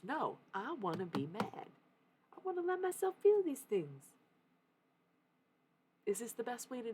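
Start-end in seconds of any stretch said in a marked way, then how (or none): 0.0-4.1 rising to a high, loud plea; emotional insistence. Acted. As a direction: insist on it, climbing into a high cry. Acting out her moody insistence.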